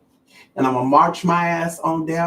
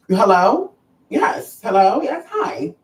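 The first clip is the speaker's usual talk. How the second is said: mock-polite greeting with a lifted lilt; over-polite hellos, rising at the end; 4 semitones higher, slower than usual.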